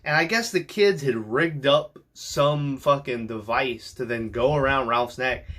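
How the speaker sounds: usual speaking voice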